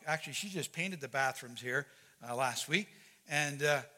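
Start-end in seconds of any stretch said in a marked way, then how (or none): none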